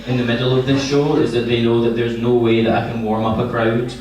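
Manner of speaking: ordinary talk, nothing marked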